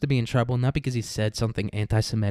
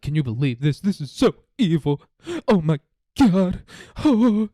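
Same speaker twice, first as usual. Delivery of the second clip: higher and louder, whiny fake shock; mock outrage; 5 semitones higher, 6 dB louder than usual. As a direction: ham up his pearl-clutching, slowing at the end. Mocking Portnoy's outrage.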